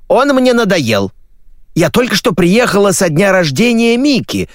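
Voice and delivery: high, imperious mother's voice; shouting mother. Acted. Mother ordering her son to discuss his wedding, shouting. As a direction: rattle off demands in a high shout. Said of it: "закричала маменька".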